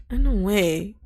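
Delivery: ordinary talk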